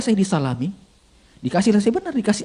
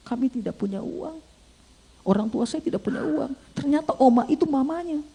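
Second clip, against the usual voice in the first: soft, raised plaintive voice; tearful confession; 7 semitones higher, 6 dB quieter than usual. Retold or imitated. Young man telling pastor his family has no money, tearful. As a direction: plead it high and quiet, imitating his weeping. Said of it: "Ketika dia menangis".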